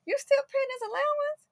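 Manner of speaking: high, surprised friend's question; lightly voiced friend, half disbelieving; friend asking, surprised. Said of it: "She said".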